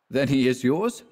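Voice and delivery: ordinary delivery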